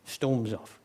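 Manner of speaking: muttered sulk. Retold or imitated. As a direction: grumble it under your breath. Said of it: "He's like a sulky teenager".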